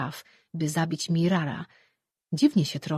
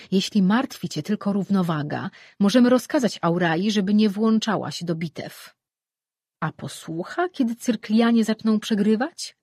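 raised, insistent god's voice, a little higher; a goddess pressing her point, raised and insistent; god arguing; 4 semitones higher, 6 dB louder than usual. Acted. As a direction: push the argument like a goddess in council.

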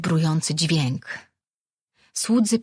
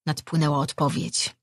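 ordinary delivery

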